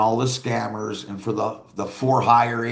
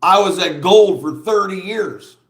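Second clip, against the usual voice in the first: a touch of a proud boast, higher and louder; lightly mock his bragging; lightly voiced self-important boast; imitating him; 6 semitones higher, 6 dB louder than usual. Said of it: "And then he goes".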